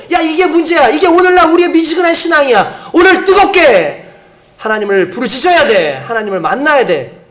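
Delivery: loud, high, slowed on 뜨겁게; urgent call. Retold or imitated. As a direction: cry out the warning to the church. Voicing God's urgent call. (성도들에게 외치는 뜨거운 외침)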